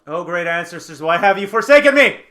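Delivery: loud, rising cry to the heavens; mock lament. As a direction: wail it out like a hammed-up hero. Fighter laments his fumble aloud.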